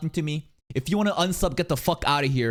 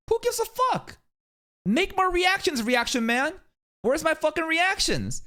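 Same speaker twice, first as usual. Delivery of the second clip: high mocking fan voice; mock uncaring fan; 13 semitones higher than usual. Fan brushing off the creator's troubles, high mocking demand. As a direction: sneer it like a callous viewer. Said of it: "voicing uncaring fans".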